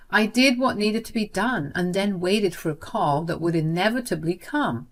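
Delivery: plain reading voice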